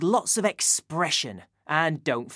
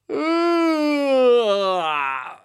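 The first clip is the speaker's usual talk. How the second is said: big yawn; 5 semitones higher than usual. Shown as a demonstration. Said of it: "big yawn".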